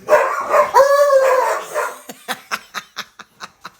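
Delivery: squealing high burst of laughter; high delighted cackle. Real, not acted. Laughing at dog scuffle.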